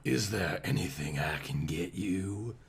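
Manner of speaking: soft, low Southern nurse voice; sexy Southern accent. A narrator's reading. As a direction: reassure him like a Southern belle nurse. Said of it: "accent of a sexy southern peach".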